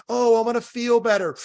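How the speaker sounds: high, put-on wishful voice; mocking high-pitched wish; mock self-soother. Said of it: "That's like".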